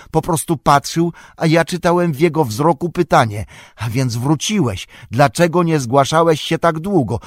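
high voice, building from hesitant to loud distress; anxious, high-pitched account gathering force. A narrator's reading. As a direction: relive a terrifying vision with rising agitation. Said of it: "słowa jego brzmiały coraz wyraźniej i donośniej".

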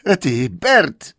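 high, loud, slow troll cry; troll calling out. Acted. Troll William shows his catch to Bert with a loud, high call. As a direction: bellow it out like a proud hunter troll. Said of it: "zawołał William".

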